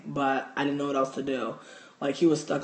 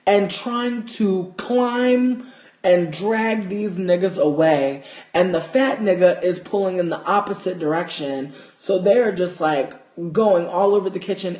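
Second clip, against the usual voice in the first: much louder, worked-up telling; hyped storytelling; 9 dB louder than usual. Retold or imitated. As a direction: tell it loud and breathless with excitement. Excitedly recounting mouse chaos.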